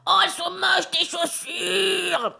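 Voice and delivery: the aunt shrieking, very high and slow at the end; aunt's voice. Retold or imitated. Aunt mocking her niece's shoes, high shrieking imitation. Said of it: "elle parle vraiment comme ça".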